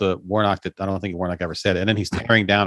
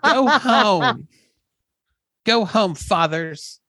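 mock preachy scold; 9 semitones higher, much slower than usual. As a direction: scold the fathers in a put-on preachy voice.

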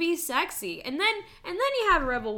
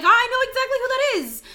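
mock recognition; 14 semitones higher, 11 dB louder than usual. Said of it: "And you're like".

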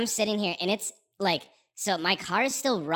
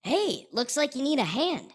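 higher, cheery helper voice; friendly stranger; 8 semitones higher than usual. Retold or imitated. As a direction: call out like a helpful local. Truck driver offering help.

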